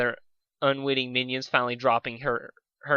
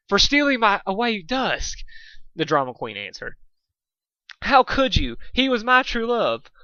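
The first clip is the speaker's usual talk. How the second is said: drama queen; 8 semitones higher, 4 dB louder than usual. Acted. Drama queen accuses friend.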